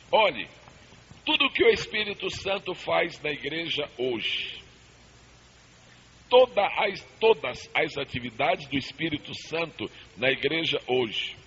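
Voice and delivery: ordinary talk, nothing marked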